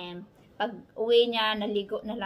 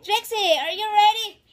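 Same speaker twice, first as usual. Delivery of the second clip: high loud call to the dog; 11 semitones higher, 7 dB louder than usual. Real, not acted. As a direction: call her from across the room. Calling the dog from afar.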